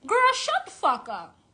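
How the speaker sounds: sharp loud put-down; a high, loud furious snap; angry retort. Real, not acted. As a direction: cut her off hard. Angry retort to other witches.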